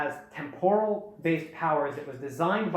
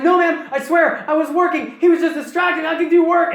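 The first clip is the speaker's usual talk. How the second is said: loud, high-pitched pleading excuse; mock frantic protest; 12 semitones higher, 10 dB louder than usual. Nuju protesting to the guard who burst in, mock frantic protest. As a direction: blurt out excuses as if caught slacking.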